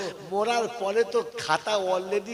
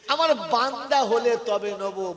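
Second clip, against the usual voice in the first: quoting scripture; 5 semitones higher, 5 dB louder, much slower than usual. Acted. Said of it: "আল্লাহ পাক শুনিয়ে দিয়েছেন".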